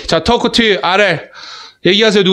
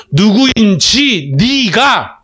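slow, elongated demonstration phrasing; drawn-out reading; much slower than usual. Shown as a demonstration. Teacher shows students one word order, drawn out. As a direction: stretch each chunk out slowly. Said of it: "이렇게 읽어도 되고".

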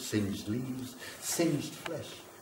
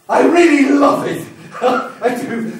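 much higher and louder, bursting with love for fire; ecstatic outburst; 12 semitones higher, 11 dB louder than usual. Acted. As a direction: explode with passion on really. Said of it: "And I love it".

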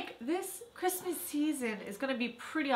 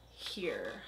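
much lower, smug mock voice; cocky friend; 13 semitones lower, 8 dB quieter than usual. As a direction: drop it low like a smug show-off. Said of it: "He goes like".

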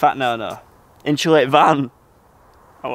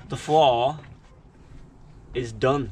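slow, with long gaps between phrases; deliberately drawn-out delivery; talking really slowly; 4 dB quieter, slower than usual. Shown as a demonstration. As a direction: spell it out slowly, pausing midway. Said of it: "that's why I'm talking really slowly".

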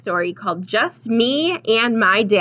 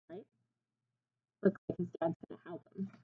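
ordinary delivery

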